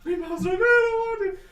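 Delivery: high, whiny protest; whining kids. Acted. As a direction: whine like a tired child. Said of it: "all the kids are like".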